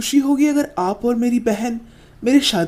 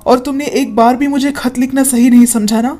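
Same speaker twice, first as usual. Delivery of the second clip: hurt scolding; 8 dB louder than usual. Acted. Brother scolds sister for hiding abuse.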